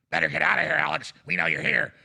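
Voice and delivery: demon voice. Retold or imitated. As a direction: menace like a demon. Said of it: "talk to me like a demon".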